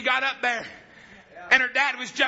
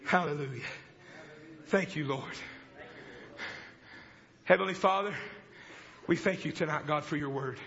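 soft, lower prayer voice; closing prayer; 4 semitones lower, 7 dB quieter than usual. Acted. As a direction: pray quietly over the congregation. Preacher leads closing prayer.

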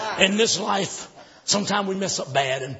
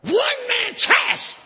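impassioned shouted sermon, peaking high; full-force preaching; 5 semitones higher than usual. Acted. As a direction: rouse the congregation with each phrase.

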